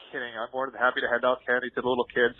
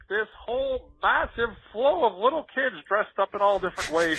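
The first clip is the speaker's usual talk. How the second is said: ordinary talk, nothing marked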